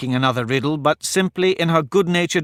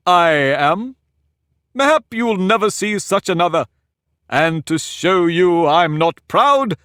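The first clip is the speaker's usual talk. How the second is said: high, slow, lordly Humpty Dumpty voice; pompous egg lecturing a girl; haughty egg; 5 semitones higher, 5 dB louder, slower than usual. Acted. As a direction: talk down to her from the wall, higher and slower. Humpty boasting of the king.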